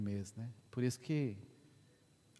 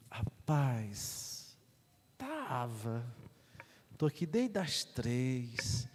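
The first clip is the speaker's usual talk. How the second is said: slow, drawn-out hedging answer; reluctant reply; much slower than usual. Retold or imitated. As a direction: stretch it out, half-hearted and weary. Retold weary reply to penitent. (resposta relutante, arrastada)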